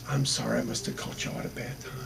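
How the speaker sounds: soft, fast, breathy-quiet apology; meek apology. Retold or imitated. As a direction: back away apologizing under your breath. Old lady's meek reply.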